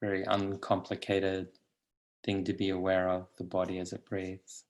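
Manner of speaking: calm, unhurried guidance with long pauses; guided meditation. Acted. Teacher settling meditators into the body, calm guided meditation. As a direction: lead them gently into stillness.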